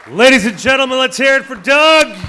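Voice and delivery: booming announcer call over speakers; over a PA system. Real, not acted. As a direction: hype the crowd through the mic. Auctioneer booms intro into mic.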